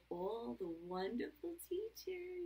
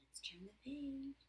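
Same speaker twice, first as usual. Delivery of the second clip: usual speaking voice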